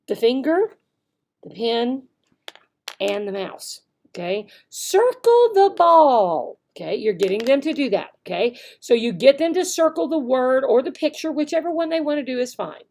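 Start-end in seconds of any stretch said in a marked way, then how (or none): 4.7-6.6 high, slow, loud and sing-song; teacher voice. Shown as a demonstration. Teacher modeling for a young learner, high and slow. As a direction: draw the child in playfully. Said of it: "showing them what you want".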